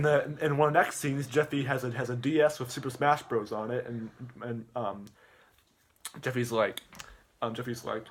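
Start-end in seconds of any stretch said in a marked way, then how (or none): none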